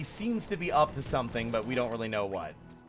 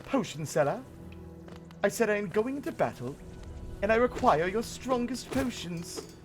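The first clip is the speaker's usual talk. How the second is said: pompous knight; 6 semitones higher than usual. Pretend. Knight insisting again.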